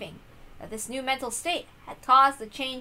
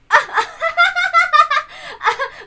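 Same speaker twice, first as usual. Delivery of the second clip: high, loud startled giggle; surprised laugh; 9 dB louder than usual. Acted.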